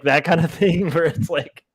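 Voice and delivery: ordinary talk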